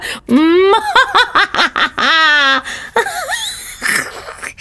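hammed-up villain laugh; evil cackle. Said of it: "I'm only messing".

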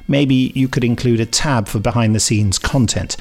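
usual speaking voice

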